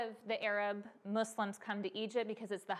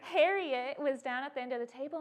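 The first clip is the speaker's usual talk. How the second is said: ordinary talk, nothing marked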